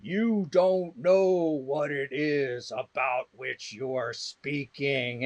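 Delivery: slow, weighty put-down, sinking in volume; thundering authority. Retold or imitated. Old professor silencing the committee, imitated with slow, thunderous authority. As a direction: pronounce it slowly like Zeus passing judgment. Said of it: "it was like Zeus threw the thunderbolt".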